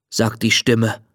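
ordinary delivery, nothing marked

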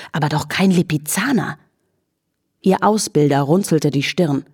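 ordinary delivery, nothing marked

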